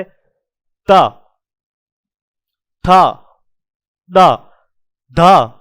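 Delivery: त थ द ध each tapped on the teeth, त breathed; teacher sounding out the त row; tongue-on-teeth demo. Shown as a demonstration. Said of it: "जीभ ये अपने दांतों को स्पर्श करते हुए".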